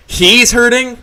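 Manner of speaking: incredulous outburst at the boss; outraged repeat. Acted. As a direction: explode on the repeat. Disbelief turning to rage.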